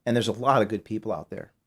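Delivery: quiet, fighting back tears; choked up. Real, not acted. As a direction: voice dropping soft with emotion. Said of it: "I'm gonna get emotional here".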